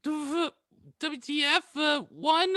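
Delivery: ordinary talk, nothing marked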